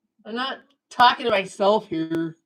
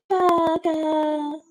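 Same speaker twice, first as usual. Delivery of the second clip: higher, slow mock Japanese woman saying hamburger as ah-gah; Japanese woman impression; 7 semitones higher, much slower than usual. Streamer imitating a Japanese woman for chat, high and slow. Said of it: "I'll say it like a Japanese woman would".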